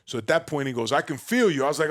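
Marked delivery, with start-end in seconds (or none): 0.9-1.6 loud, raised guru impression; big-voiced imitation of a motivational speaker; Tony Robbins. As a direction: project it like a big seminar coach. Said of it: "with his big Tony Robbins voice".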